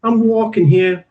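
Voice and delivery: put-on high, drawn-out Bronx accent. Australian guest parodies the go-to American voice, Bronx accent. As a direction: put on a stock Bronx New Yorker accent. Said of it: "put on that Bronx accent".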